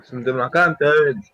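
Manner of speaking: loud, slow insistent call; loud yelling. Real, not acted. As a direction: yell the location out slowly. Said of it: "Deci nu mai urla". (strigat tare și rar)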